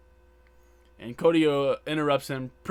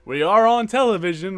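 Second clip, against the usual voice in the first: exasperated quote; 7 semitones higher, 9 dB louder than usual. Retold or imitated. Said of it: "And he's like".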